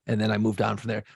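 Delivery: ordinary delivery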